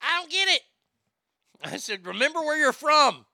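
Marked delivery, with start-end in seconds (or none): 0.0-0.6 high, louder confused mock voice; hammed-up imitation of a puzzled woman; imitating her confusion. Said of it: "and she's like". 2.0-3.3 high, louder teasing voice; mock-stern put-on voice; pointed reminder. Retold pointed reply to her.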